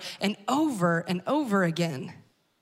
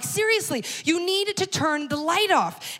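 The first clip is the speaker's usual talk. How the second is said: exasperated insistence; 6 semitones higher than usual. Retold or imitated. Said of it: "I'm like".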